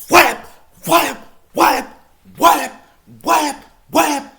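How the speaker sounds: loud, high mock punches; punch sound effects. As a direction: slap out each hit. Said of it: "And I'm like".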